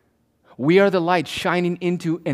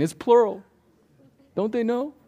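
put-on higher, piping little-child voice; mock baby voice; 8 semitones higher than usual. Pastor imitating a baby in the congregation, higher piping voice. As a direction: play a small child stating the obvious. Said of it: "The little baby saying".

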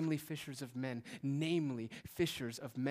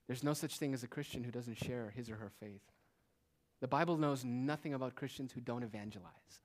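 normal talking voice

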